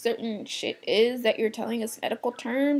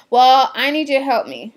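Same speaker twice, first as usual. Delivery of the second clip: mimicking a demanding shopper, louder and pushier; pushy customer; 3 semitones higher, 9 dB louder than usual. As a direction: play the entitled customer, a bit louder.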